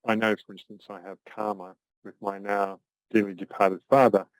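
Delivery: usual speaking voice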